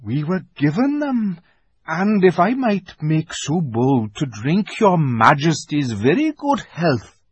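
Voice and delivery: put-on a courteous old fox trying to please; a hint of a deferential fox; lightly voiced old fox. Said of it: "said the fox".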